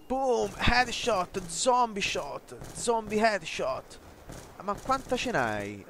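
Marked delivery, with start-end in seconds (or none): 0.1-4.0 high, slow drawn-out callout; hammed-up announcer. Gamer announcing his own headshots in a mock announcer voice. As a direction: call it like a game announcer.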